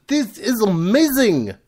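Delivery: put-on high, drawn-out French accent. Arthur from France has said it, in a French accent. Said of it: "That was my French accent".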